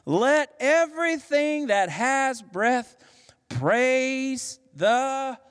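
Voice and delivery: high, slow climactic proclamation; exploding praise. Acted. Preacher reaching the psalm's end, exploding in praise. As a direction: stretch it out as the psalm's big climax. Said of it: "at the end it just explodes".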